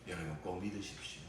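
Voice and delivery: usual speaking voice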